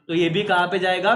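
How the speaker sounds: ordinary talk, nothing marked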